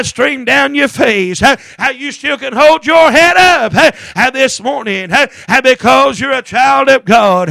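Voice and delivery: ordinary talk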